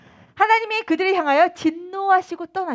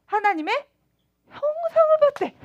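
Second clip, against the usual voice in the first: amazed; 11 semitones higher, 3 dB louder than usual. Real, not acted. Marvelling at Moses seeing God.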